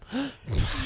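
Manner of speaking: high, breathy fake gasp of outrage; mock gasp. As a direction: gasp like a scandalised tweeter.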